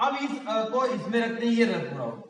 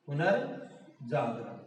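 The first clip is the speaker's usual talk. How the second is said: पुनर्जागरण drawn out, पुनर् apart from Jagran; slow, quiet repeat in two parts, पुनर् then Jagran; slow dictation; 6 semitones lower, 6 dB quieter, much slower than usual. Shown as a demonstration. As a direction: say पुनर्जागरण at half speed, low and soft. Teacher repeats it slowly while writing.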